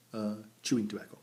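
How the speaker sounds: usual speaking voice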